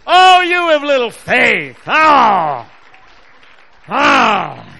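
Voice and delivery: slow, high, booming exclamation; mock rebuke. Preacher crying out at little faith, loud mock reproach. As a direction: shout it out in drawn-out reproach.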